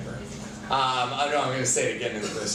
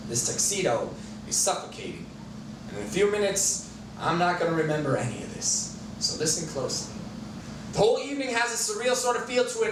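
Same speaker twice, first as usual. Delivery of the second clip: higher, projected slam-poetry cadence with dramatic pauses; poem recital; 7 semitones higher than usual. Acted. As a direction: perform a love poem to a live audience. Performs poem as a mouse.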